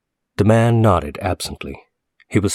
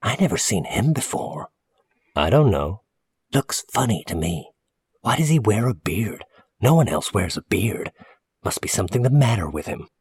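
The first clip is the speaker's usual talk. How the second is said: higher-pitched, hushed gossip; suspicious woman customer; 4 semitones higher than usual. Acted. Customer to storekeeper about a bearded stranger, suspicious. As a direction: voice a nosy woman sharing her suspicions. Narrator voices suspicious Missus Hackett.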